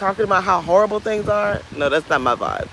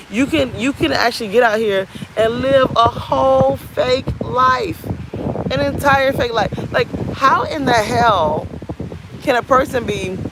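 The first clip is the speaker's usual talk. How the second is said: higher-pitched, incredulous venting; exasperated rant; 6 semitones higher than usual. Real, not acted. Venting about people living fake lives, exasperated. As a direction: call out the fakery, pitch riding high.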